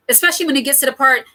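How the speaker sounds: usual speaking voice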